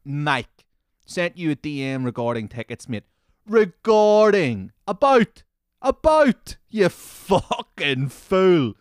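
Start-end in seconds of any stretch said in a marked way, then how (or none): 3.4-8.8 loud, high, indignant shouting; high-pitched, drawn-out exasperated yell; indignant rant. Real, not acted. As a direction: shout each correction slowly and scornfully. Mocking the commenter's word choice.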